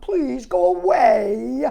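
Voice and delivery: slow, loud pleading Frog; crying out. Acted. Frog begging the animals to go away. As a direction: beg them to leave. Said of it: "cried Frog".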